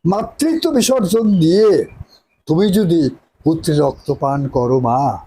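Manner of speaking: impassioned theatrical plea to a mother; hammy stage actor. Acted. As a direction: recite with stage drama, pleading. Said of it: "আরেকটা বলেন".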